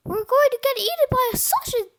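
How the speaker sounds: high, loud comic dread; panicked cry. A narrator's reading. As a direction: wail it in storybook alarm. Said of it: "cried Cheeseball".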